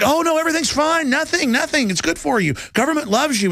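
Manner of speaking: high, mock-sweet sarcasm; high mock-soothing voice; sarcastic reassurance. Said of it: "and I was like".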